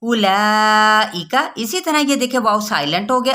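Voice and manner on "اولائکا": اولائکا slow, the waw silent, the alif stretched; tajweed recitation. Shown as a demonstration. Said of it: "جلدی سے کہنا ہے".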